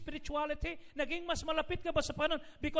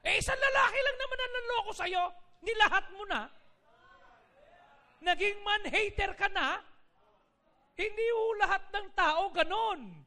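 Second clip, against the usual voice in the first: raised scolding; 6 semitones higher, 4 dB louder than usual. Acted. Pastor scolds imagined man-hater.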